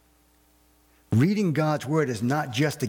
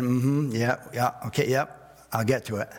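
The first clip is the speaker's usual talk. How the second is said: distracted brush-off. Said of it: "He's not watching the Super Bowl saying".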